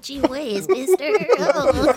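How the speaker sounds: put-on high gee-whiz kid voice; wholesome 1950s kid. Bashful thanks after compliment.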